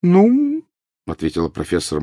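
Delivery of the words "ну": high, drawn-out hesitation; less businesslike, hesitant. A narrator's reading. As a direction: professor losing some of her briskness. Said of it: "отчасти утратившим прежнюю деловитость тоном".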